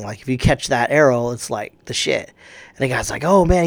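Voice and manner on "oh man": mock scared. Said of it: "he's all scared and shit".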